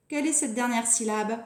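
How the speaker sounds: normal talking voice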